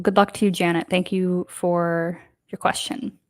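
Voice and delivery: usual speaking voice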